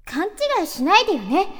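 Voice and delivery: high, loud tsundere line. Acted. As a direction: snap it like a flustered anime girl. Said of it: "規定セリフ言った方がいい".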